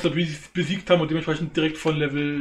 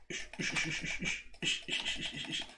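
whispering; 12 dB quieter than usual. Real, not acted. Tense careful fight.

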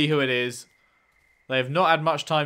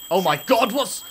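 startled; 6 semitones higher, 7 dB louder than usual. Real, not acted. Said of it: "That made me jump".

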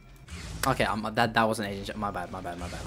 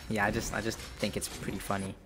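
usual speaking voice

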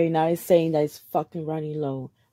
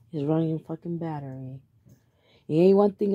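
normal talking voice

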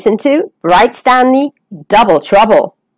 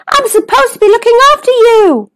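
high-pitched, worried call; 12 semitones higher than usual. Acted. Stanley minding his little cousin, calling out high. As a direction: call after them in a high voice. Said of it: "called Stanley".

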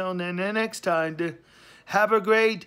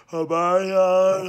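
talking through a loud yawn; word stretched into a noisy yawn; 5 dB louder than usual. Real, not acted. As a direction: let a big loud yawn swallow the word. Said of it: "there's an loud yawning".

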